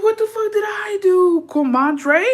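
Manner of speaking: high, slow, stunned disbelief; shocked what the fuck. Retold or imitated. Retelling being unfollowed, high stunned disbelief. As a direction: gasp it out like you can't believe it. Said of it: "I was like".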